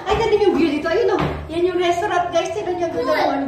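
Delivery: real excited exclamation over the view; suddenly loud. Real, not acted. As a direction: burst out delighted at the view. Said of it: "Bigla lumakas yung boses ko".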